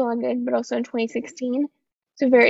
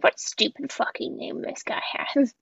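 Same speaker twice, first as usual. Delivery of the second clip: whispered mock disbelief; whispered scoff at a silly name; 6 dB quieter than usual. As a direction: hiss it out like a scornful aside. Mocking the characters' reaction.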